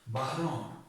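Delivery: normal talking voice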